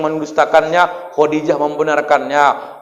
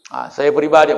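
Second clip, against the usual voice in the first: normal talking voice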